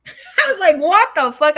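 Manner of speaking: loud, high burst through laughter; laughing disbelief. Retold or imitated. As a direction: laugh while retelling the shocked reaction. Laughing at retold shocking request.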